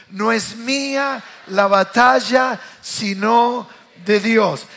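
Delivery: loud, slow call for the crowd to repeat; preaching call and response. Acted. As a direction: make them echo it back. Leads congregation repetition. (llamado a repetir, fuerte y lento)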